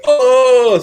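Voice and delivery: high loud hammed-up oh; a big teasing gasp of surprise; mock surprised oh. Friends react to the rejection.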